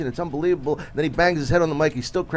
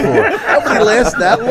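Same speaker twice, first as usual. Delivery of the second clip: higher, louder, slowed mocking repeat; incredulous echo; 9 semitones higher, 9 dB louder, slower than usual. Comic repeating the manager's line back to him, mocking and laughing. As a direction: throw his words back at him in disbelief. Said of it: "I go".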